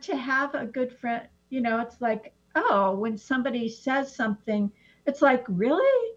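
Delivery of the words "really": a high, doubting "Really?"; challenging really. Retold or imitated. Friend challenging a weird remark, high doubting. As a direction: call the claim out, rising high. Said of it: "to challenge somebody and go".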